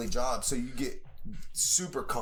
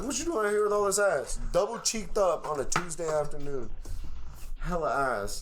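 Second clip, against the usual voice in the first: catcall; 4 semitones higher than usual. Retold or imitated. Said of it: "I'm like".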